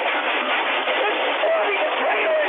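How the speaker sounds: high, drawn-out, mocking cry of panic; mock panicked caller. Host parodying the Texas caller, high mock panic.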